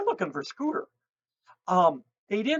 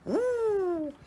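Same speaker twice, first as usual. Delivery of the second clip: owl greeting hoot; 12 semitones higher than usual. Retold or imitated. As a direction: coo up high like a happy owl. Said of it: "which is him saying".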